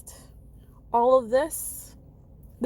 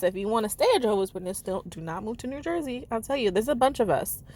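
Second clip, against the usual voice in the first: ordinary delivery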